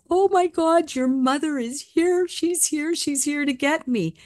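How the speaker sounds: high, thrilled cry of recognition; overjoyed dying father. Retold or imitated. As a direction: act out amazed joy, voice way up. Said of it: "he had the biggest smile".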